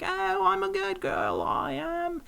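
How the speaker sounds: hammed-up high Cockney girl protesting; Cockney flower girl. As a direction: squeak it out like the My Fair Lady flower girl. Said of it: "the girl from the East End of London that's like".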